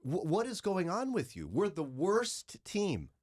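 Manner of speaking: emphatic disbelief, leaning hard on key words; exasperated confrontation, retold. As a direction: act out setting a deluded parent straight. Acted confrontation with deluded parents.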